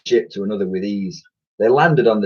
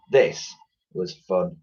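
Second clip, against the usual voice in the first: ordinary delivery, nothing marked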